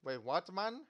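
bad put-on Indian accent. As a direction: ham up a clumsy Indian accent. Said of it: "shut up with the freaking, freaking Indian accent".

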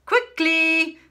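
loud, high snapped "Quickly!"; shouting. A narrator's reading. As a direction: hurry the old cleaner out. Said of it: "shouted Miss Prigg".

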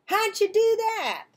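high, loud amazed question; amazed boy. A narrator's reading. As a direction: marvel at the trick. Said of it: "cried Nick".

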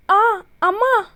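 cry of pain. A narrator's reading. Devu crying out as her bound hands are tightened. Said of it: "വേദനയോടെ അലറിപ്പോയി ദേവു".

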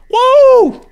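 high loud victory whoop; excited whoop. Real, not acted. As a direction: whoop it out in triumph. Escaping, celebrating.

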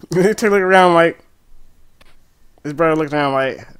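ordinary talk, nothing marked

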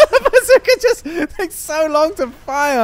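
high, laughing complaint. Real, not acted. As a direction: complain through helpless laughter. Laughing at his slow weapon.

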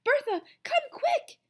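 high, loud, slow breathless call; teacher rushing in out of breath; panting. A narrator's reading. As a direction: gasp it out after running. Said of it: "she panted".

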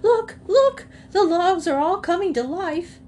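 high, loud squawking hen voice; an alarmed hen crying out, high and loud; excited hen cackle. Acted. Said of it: "began to cackle excitedly".

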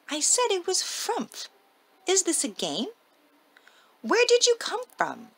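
high, squeaky little pixie. Acted. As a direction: play the tiny pixie, eager. Pixie answers Santa.

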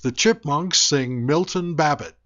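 ordinary talk

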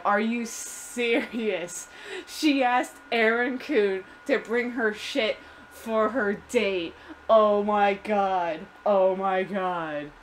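put-on slow, incredulous Valley Girl drawl. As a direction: drag out the vowels like a scandalised Valley Girl. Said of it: "like Valley Girl".

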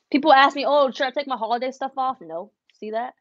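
ordinary talk, nothing marked

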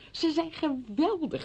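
high, slow, imitated rave; quoted gushing praise. Visitor quoting friends who saw the play, mock-gushing. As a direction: draw out the praise, pitched well up. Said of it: "zeiden ze".

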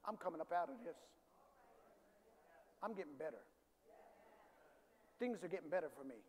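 low, soft self-encouragement; talking oneself up. Shown as a demonstration. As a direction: quietly reassure yourself, pitched low. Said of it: "talk to yourself like that".

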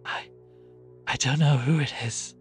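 faltering, frightened gasp; hesitate, then gasp it out. A narrator's reading. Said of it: "gasped Cordelia".

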